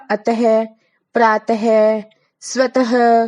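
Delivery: अतः प्रातः said with a clear breathy ह at the end; visarga as ह. Shown as a demonstration. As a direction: end each word in a puff of ह. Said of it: "है की तरह होती है". (विसर्ग को ह की तरह बोलें)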